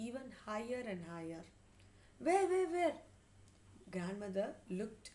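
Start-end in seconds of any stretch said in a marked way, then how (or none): 2.3-3.0 high, loud quick repeats; frantic questioning. A narrator's reading. Grandmother looks everywhere for the mouse, frantic questioning. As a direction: ask urgently in a raised pitch.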